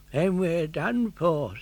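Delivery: put-on slow, resigned old man; heavy with defeat, slow; old Geppetto. Geppetto giving up at sea, slow and resigned.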